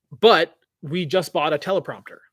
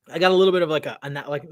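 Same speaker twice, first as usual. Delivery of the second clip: ordinary delivery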